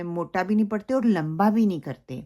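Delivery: usual speaking voice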